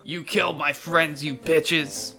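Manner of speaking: aggrieved goblin. Acted. Goblin books it down the trail, aggrieved yell. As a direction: accuse the killers as you flee. Fleeing goblin yells back.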